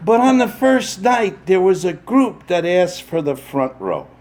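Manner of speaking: normal talking voice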